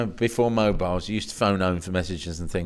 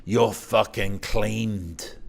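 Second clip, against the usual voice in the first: slow menacing threat; 3 dB quieter, much slower than usual. Retold or imitated. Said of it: "Like that".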